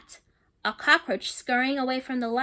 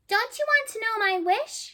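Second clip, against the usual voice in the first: little sister; 8 semitones higher than usual. Acted. Carmela asks her brother.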